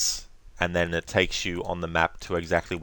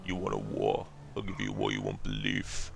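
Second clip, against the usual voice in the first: low, softer understated Stallone voice, dropping lower on the threat; natural Stallone; 10 semitones lower, 7 dB quieter than usual. Shown as a demonstration. Gamer trying a naturalized Stallone movie line. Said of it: "impression of Sylvester Stallone that's naturalized".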